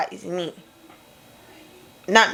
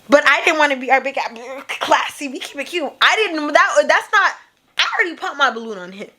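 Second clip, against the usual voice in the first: normal talking voice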